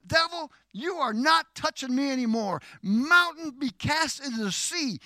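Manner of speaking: high, forceful preacher's rebuke; yelled command. Shown as a demonstration. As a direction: cry out as if ordering a mountain away. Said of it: "Are you yelling it so you tell it?".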